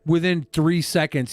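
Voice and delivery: usual speaking voice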